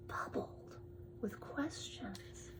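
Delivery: slow soft storybook whisper; hushed mysterious reading. Acted. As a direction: stretch the words out in a hush.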